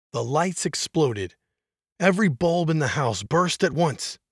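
usual speaking voice

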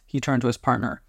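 plain reading voice

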